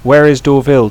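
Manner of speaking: ordinary talk, nothing marked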